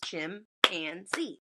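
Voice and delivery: Chimpanzee very slow, chim, pan, zee, each apart; very slow, counted-out Chimpanzee; three syllables. Shown as a demonstration. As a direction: separate each beat of Chimpanzee with a gap. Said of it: "Three syllables".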